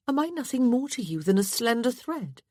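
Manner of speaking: high-pitched, wounded question; hurt wife. A narrator's reading. Wife asking husband what she means to him, hurt.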